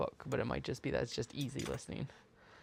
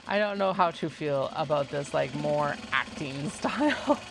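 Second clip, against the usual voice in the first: mock bewilderment at the narration; baffled reaction; 6 dB louder than usual. Reacting to acted-out audiobook chuckles, puzzled and laughing. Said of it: "I'm like".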